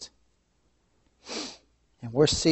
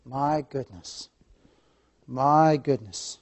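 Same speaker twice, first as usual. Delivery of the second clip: hushed sigh; 2 semitones lower, 7 dB quieter, slower than usual. Real, not acted. Sighing wonder, repeated.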